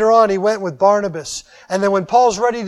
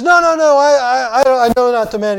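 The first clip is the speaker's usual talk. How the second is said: frantic denial; 4 semitones higher, 4 dB louder than usual. Retold or imitated. Peter's frantic third denial.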